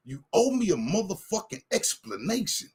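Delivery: usual speaking voice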